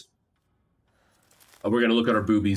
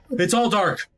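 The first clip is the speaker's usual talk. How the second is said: high gasping panic; gasp, then cry out in alarm; panicked gasp; 12 semitones higher, slower than usual. Real, not acted. Lights go out.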